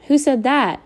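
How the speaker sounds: high, sharp outraged question; crying out. A narrator's reading. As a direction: shoot up in pitch, outraged. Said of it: "I cried".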